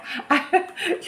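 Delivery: short high breathy laugh; a quick embarrassed giggle; little laugh. Real, not acted. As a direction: laugh at yourself softly.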